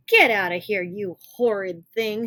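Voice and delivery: Granny's loud, indignant shout; Granny furious at the wolf, shouting; furious cry. Acted. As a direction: scold the wolf loudly. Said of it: "she cried".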